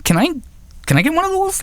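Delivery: very high-pitched, imitated awkward request; mock guy asking. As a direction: mimic a man's request in a silly high voice. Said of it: "if you're a guy, you don't just walk up".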